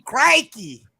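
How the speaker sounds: high, loud Australian exclamation; Australian accent. Shown as a demonstration. Proving they can do better Australian, loud hammed-up Australian accent. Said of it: "do a better Australian accent".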